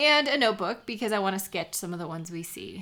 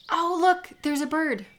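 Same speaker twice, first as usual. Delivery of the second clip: higher and louder, excited spotting; sudden delight; 7 semitones higher, 4 dB louder than usual. Real, not acted. Friend spotting a bird on the trailhead sign, excited. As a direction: point it out with a gasp of joy.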